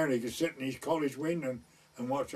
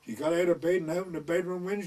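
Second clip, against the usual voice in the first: ordinary talk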